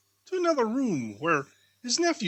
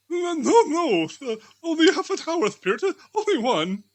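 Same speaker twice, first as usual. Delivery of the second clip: higher, louder, rushed begging; pleading Scrooge; 12 semitones higher, 7 dB louder than usual. Acted. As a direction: beg the spirit for more time. Scrooge begs the spirit.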